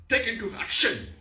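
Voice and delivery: high, loud angry command; furious rage. Shown as a demonstration. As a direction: order the attack in anger. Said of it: "Red with anger".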